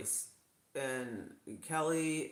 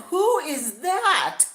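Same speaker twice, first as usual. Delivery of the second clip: confused viewer; 17 dB louder, much slower than usual. Retold or imitated. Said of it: "You guys would be like".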